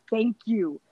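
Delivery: normal talking voice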